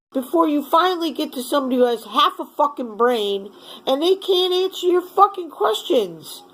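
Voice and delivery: ordinary talk, nothing marked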